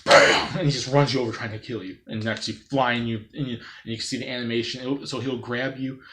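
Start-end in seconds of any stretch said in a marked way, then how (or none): 0.0-0.6 loud bam. Acted. Car ramming opponents.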